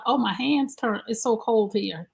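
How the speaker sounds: ordinary talk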